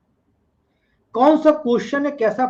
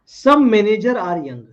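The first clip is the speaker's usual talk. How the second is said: slow statement read; 5 dB louder, much slower than usual. Shown as a demonstration. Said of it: "reading the statement slowly".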